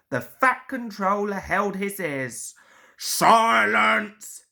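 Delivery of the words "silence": louder commanding shout; bellow. A narrator's reading. The Fat Controller silences the cheering engines, loud shout. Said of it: "he bellowed".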